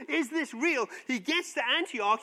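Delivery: ordinary delivery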